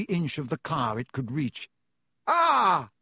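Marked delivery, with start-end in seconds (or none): 2.3-2.9 high, loud falling yelp; cry of alarm. A narrator's reading. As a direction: yelp at a sudden blow. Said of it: "said Ron".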